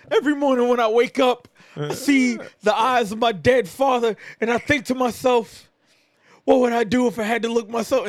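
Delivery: high, loud, intense promo voice; mock dramatic wrestler promo. Imitating Eddie Kingston's brooding promo, loud and high. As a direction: ham up a brooding monologue. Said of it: "And Eddie's just like".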